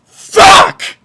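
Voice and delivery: strained breathy cry of frustration; frustrated outburst. Real, not acted. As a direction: blurt it out in exasperation. Outburst of frustration mid-rant.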